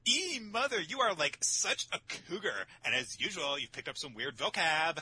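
put-on high, swooping valley-girl whine. Daughter calling her mother a cougar, valley-girl disdain. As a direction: play a disgusted teenage valley girl. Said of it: "suddenly Alice is a fucking valley girl".

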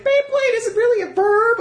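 very high mock whine; hammed-up squeaky protest; whiny objector. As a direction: squeal it like a pedantic naysayer. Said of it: "They're like".

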